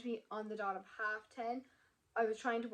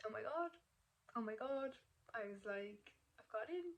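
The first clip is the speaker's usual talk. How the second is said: emotional, overwhelmed; 7 dB quieter than usual. Retold or imitated. Student retelling getting into college, quietly overwhelmed. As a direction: relive the news in a small choked-up voice. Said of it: "You can get a bit emotional now".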